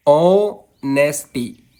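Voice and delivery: Honesty in slow syllables, weight on ऑ; long ऑ, then नेस and टी apart; stress on first syllable. Shown as a demonstration. Teacher giving a dactyl example, slow stress on ऑ in honesty. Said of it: "पहला दोस्तों stressed होता है".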